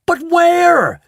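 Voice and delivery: very high, loud shriek. A narrator's reading. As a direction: shriek the question. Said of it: "shrieked Missus Salt".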